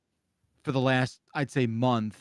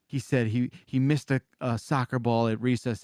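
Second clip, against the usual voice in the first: usual speaking voice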